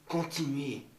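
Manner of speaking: ordinary talk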